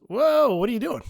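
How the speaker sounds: put-on dad catching his kid red-handed; retold startled dad.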